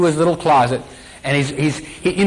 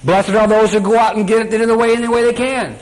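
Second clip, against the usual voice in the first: raised, pushy huckster pitch; mocking distorter voice; 6 semitones higher than usual. Preacher voicing the soul's distorter, higher and mocking. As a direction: sell the corrupt blessing like a con man.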